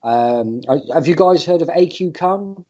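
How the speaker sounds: normal talking voice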